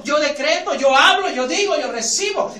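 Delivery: loud, boastful declaring; arrogant. Retold or imitated. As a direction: proclaim it like a man giving God orders. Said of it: "si tú vienes arrogante".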